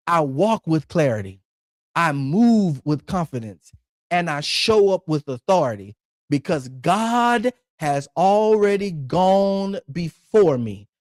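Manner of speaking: slow, measured phrases with long pauses; slow declaration. Shown as a demonstration. Preacher leading congregation in declaring over their lives, slow and deliberate. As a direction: say it slowly for people to repeat and write down. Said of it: "I'm gonna say it kinda slow".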